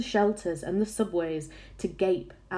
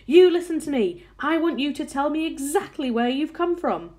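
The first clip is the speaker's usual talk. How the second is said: high, insistent demand, loudest at the start; authoritative shout; 8 semitones higher, 5 dB louder than usual. A narrator's reading. Police chief questioning the creature on the peach, shouted order. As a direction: demand an answer from someone far above. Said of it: "shouted the chief of police".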